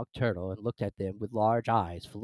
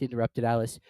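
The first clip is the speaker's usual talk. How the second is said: usual speaking voice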